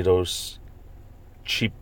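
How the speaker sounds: ordinary delivery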